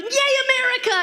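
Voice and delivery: high, loud mock cheer. Mom cheering kids on the Fourth, loud and high. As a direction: whoop it with fake patriotic glee.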